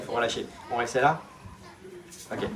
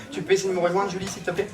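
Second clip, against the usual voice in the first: usual speaking voice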